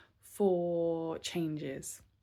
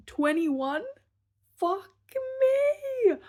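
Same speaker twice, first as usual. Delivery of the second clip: high, loud shriek of disbelief; shocked outburst; 8 dB louder, much slower than usual. Real, not acted. Vlogger catching her own age slip, shrieking in surprise. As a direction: yelp it as the mistake hits you.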